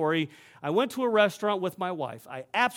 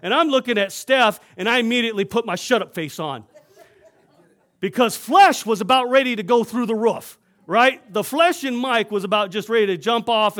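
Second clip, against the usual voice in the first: worked up; 3 semitones higher, 7 dB louder than usual. Real, not acted. Retelling anger, laughing.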